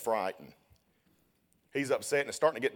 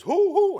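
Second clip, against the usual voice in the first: owl hoot; 13 dB louder than usual. Retold or imitated. Owl sound in the woods.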